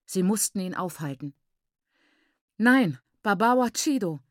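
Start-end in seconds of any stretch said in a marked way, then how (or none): 2.6-4.2 loud, urgent plea; pleading. A narrator's reading. Mother trying to stop her husband, pleading. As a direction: implore him to stop. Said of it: "bat Maiguru flehend".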